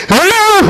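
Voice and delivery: a high mock "으아!" yelped by a fleeing son; kid's protest yell. Son running off from the preacher's advice, high mock yell. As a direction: squeal "으아!" like a teen brushing off mom's advice. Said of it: "하면서 가더라고".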